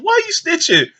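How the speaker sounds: put-on kid complaining. Boy accusing the lady of snitching, high whiny voice.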